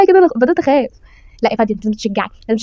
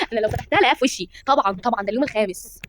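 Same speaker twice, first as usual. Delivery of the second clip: normal talking voice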